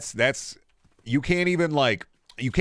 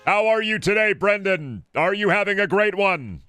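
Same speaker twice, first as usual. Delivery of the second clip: high robotic monotone, much higher and louder; old AI voice synth; 11 semitones higher, 4 dB louder than usual. Retold or imitated. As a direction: read it like a cheap text-to-speech voice. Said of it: "like an old A I voice synthesizer".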